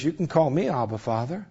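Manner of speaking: near-whispered loving invitation; whispering God. Acted. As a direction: speak tenderly to the fatherless. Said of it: "he whispers".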